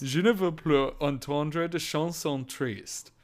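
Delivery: slow, put-on French with a stiff mouth; French through barely moving lips, a little high. Repeating the lyric for co-host, stiff-lipped French voice. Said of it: "you can move your lips".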